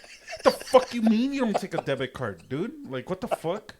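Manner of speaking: high-pitched retold protest; indignant disbelief. Customer told his debit card isn't taken, indignant. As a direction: object in exasperated disbelief. Acted objection to cash only.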